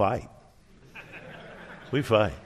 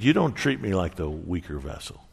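lower, grave retold reproach; 5 semitones lower than usual. Wife confronting husband after a fight, hurt. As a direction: let the hurt words sink, low.

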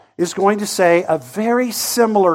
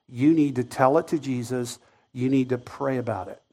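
mock glib advice; 8 semitones lower, 12 dB quieter than usual. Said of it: "I would get some version of this".

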